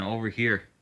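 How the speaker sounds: ordinary delivery, nothing marked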